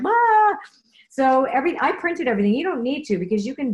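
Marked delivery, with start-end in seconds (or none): 0.0-0.6 excited yelp. Retold or imitated. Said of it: "I'm like".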